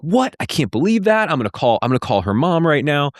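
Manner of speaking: retold gasp of disbelief, pitched high; high, stunned "What?" then rushing on; shocked mom. Mom on the phone reacting in high, shocked disbelief.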